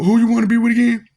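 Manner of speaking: high, loud mocking question; teasing challenge. Real, not acted. Taunting the listeners, high and loud.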